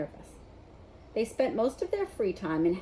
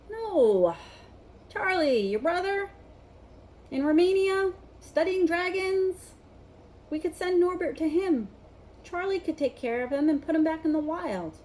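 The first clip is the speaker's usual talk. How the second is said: eager idea; 9 semitones higher, 3 dB louder than usual. A narrator's reading. Harry's excited idea.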